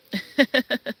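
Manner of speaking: a short high burst of laughter. Real, not acted. Reading a viewer's comment on the live stream, a bright laugh.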